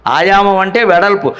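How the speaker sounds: ordinary talk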